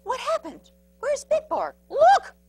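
high, startled questions building to a shout; high-pitched bewildered crowd; alarmed. A narrator's reading. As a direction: ask in high alarm, then cry out. Bewildered dogs search the sky.

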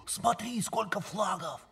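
hushed spying. Acted. Spying on sleepwalker.

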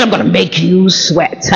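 low, slow menacing impression; dropped villain voice. Preacher retelling Tyler's threat, deep slow voice. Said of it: "going through this voice dropping thing".

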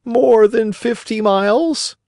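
very high, slow bird voice; pigeon answering. Acted. Pigeon telling the distance to the shore, high and slow.

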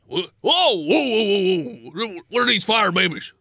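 high, loud alarmed dwarf; dwarf spotting fire babies, alarmed and loud; startled dwarf voice. Acted. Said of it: "What voice do they have?".

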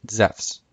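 Zephs, said zefs with an f; modern Greek pronunciation. Shown as a demonstration. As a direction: say Zephs as one syllable, zefs. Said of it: "pronounced the same way as it is".

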